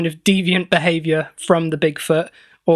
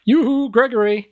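calling out; 5 semitones higher than usual. Pretend. Calling for the lost pet boy.

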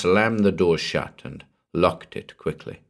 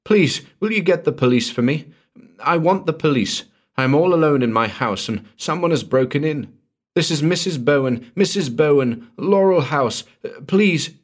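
much higher, louder desperate begging; frantic plea; 8 semitones higher, 4 dB louder than usual. Acted. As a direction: beg for help as if someone is in the house. Woman begs operator for police.

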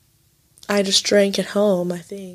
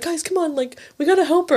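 higher, mock-urgent rallying call; urgent friend; 8 semitones higher than usual. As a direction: imitate the friend rallying the others. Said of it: "He's like".

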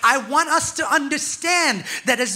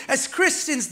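ordinary talk, nothing marked

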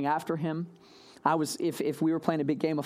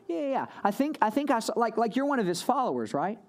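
eager accuser; 6 semitones higher than usual. Retold or imitated. Stranger presses Peter.

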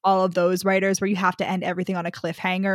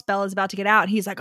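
ordinary talk

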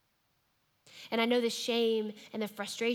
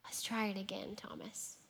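hushed, much softer invitation; gentle whisper; 9 dB quieter than usual. Retold or imitated. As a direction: whisper it to Thomas kindly. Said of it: "as if Jesus was saying".

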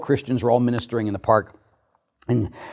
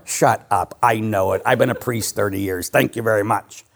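usual speaking voice

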